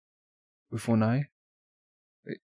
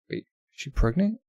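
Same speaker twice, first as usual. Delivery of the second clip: ordinary talk, nothing marked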